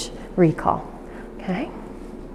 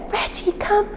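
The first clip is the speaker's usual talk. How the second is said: recall call; 13 semitones higher, 9 dB quieter than usual. Shown as a demonstration. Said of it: "if you said".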